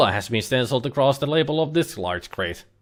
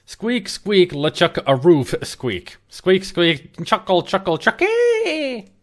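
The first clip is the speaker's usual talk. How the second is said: squeaks ending in a high chucky; squeaky ferret pun; 6 semitones higher, slower than usual. Pretend.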